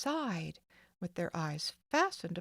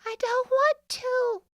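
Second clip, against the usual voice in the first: put-on higher, louder, slow child's wail; little girl wailing at the nurse; 9 dB louder than usual. A narrator's reading. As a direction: drag it out like a little girl resisting. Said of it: "wailed Gladys".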